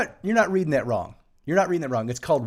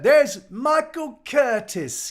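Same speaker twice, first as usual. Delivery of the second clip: slow, high, drawn-out name call, louder; Sue's way of saying it; 11 semitones higher, 6 dB louder, much slower than usual. Retold or imitated. Greeting Michael on the stream, slow and high like Sue. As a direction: stretch the name out the way Sue does. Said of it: "the way Sue says Michael Curtis".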